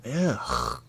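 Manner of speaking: normal talking voice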